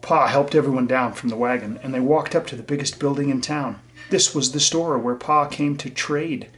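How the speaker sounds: put-on lively read-aloud for young listeners; bright, gentle storyteller voice; story reading. A narrator's reading. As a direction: read it to the class like a picture book. Reads storybook to children.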